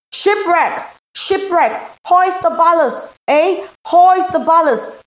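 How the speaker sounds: very high, loud frantic yells; panicked sailor hollering; crewman shouting alarm. A narrator's reading. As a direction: scream the warning across the deck. Crew shouting as ship sinks.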